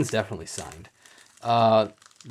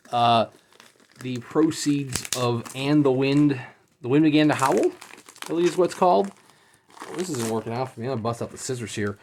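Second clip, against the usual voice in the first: normal talking voice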